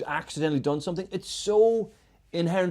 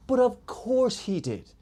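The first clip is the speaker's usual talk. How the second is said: exasperated disbelief; 9 semitones higher, slower than usual. Retold or imitated. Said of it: "And I'm like".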